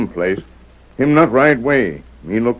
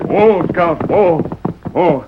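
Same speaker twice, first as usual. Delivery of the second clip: raised call, pitched up; calling to a horse; 7 semitones higher than usual. Acted. Tonto calling his horse to stop. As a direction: rein the horse in.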